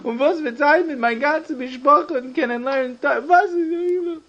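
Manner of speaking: high, wailing plea through tears; acted crying. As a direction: cry it out, begging, voice way up. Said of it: "und er weint die ganze Zeit".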